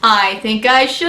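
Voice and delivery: plain reading voice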